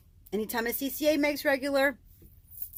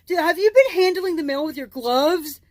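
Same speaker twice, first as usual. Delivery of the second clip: a snooty, prissy coworker voice; mimicking coworker; 7 semitones higher, 6 dB louder than usual. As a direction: put on the rude coworker's tone, higher. Coworker voiced in retold story.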